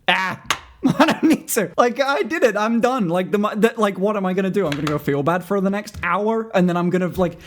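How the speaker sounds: bubbly, giggling inner monologue; giddy self-quote. Retold or imitated. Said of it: "and I went".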